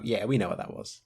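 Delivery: whispered knowing aside; knowing murmur. Retold or imitated. Onlooker noticing a runner's detour into the woods, knowing murmur. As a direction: drop to a whisper, as if in on it.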